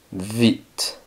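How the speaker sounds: vite said softly as "veet"; silent E, sounded T. Shown as a demonstration. Teacher introduces a new word, vite with voiced V and final T. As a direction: end vite on the T, no E. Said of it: "Y la E no suena".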